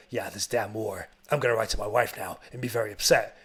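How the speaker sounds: low, soft, mock-solemn soldier; deadpan war-movie gloom, voice dropped; brooding soldier. Soldier resolving to write to his wife, low mock brooding.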